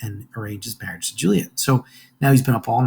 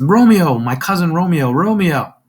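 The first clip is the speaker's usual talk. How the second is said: calling loudly into the dark, higher pitched; 7 semitones higher, 6 dB louder than usual. Acted. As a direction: call out to a friend who ran off. Calling out for lost friend.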